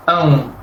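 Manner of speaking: one nasal glide from "an" into "u", ão; all joined. Shown as a demonstration. Teacher repeating the joined syllable for learners, as one sound. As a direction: merge "an" with a final "u" sound. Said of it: "pero todo junto".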